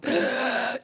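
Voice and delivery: sudden strangled gasp; high, quiet choke for air; acted-out choking gasp. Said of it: "So just randomly I go".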